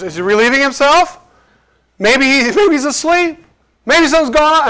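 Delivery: teasing, pitch rising up high. Retold or imitated. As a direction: taunt them, lifting the voice. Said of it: "so he's ridiculing them".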